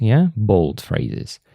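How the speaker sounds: ordinary talk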